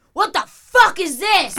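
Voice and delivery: high, slow furious yell at me; mock angry yell. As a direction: yell it like a startled angry stranger. Homeless lady woken, yelling.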